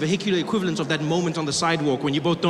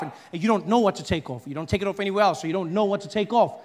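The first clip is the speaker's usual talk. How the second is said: ordinary delivery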